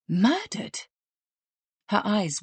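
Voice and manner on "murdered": Murdered? rising high in disbelief; shocked question. Acted. Helen echoes the manager's news, shocked rising question. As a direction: echo Murdered? in startled surprise.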